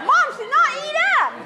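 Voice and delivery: high, fast squeaky protest; mock childish protest. Daughter protests to her mother, high fast squeal. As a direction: squeal it like a whiny kid. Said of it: "It's like".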